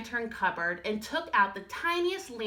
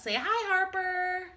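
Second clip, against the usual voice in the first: higher, slow coo for the dog; 9 semitones higher than usual. Real, not acted. Teacher coos to her dog in higher baby talk. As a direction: coax the dog to say hi.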